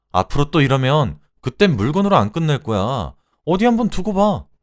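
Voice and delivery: loud, high-pitched angry rant; furious tirade. Acted. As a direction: lash out at your husband with rising outrage. Acted furious quarrel with husband. (남편에게 언성 높여 쏘아붙이기)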